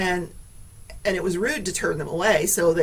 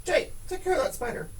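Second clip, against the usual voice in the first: ordinary delivery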